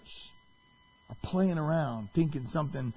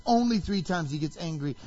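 ordinary talk

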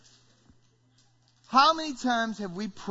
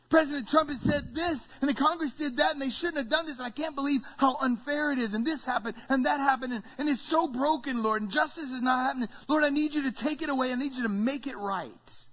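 higher-pitched, piled-up complaint; frustrated prayer; 5 semitones higher than usual. Acted. Preacher voices a believer pleading about injustice, frustrated prayer. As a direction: rattle off grievances, pleading upward.

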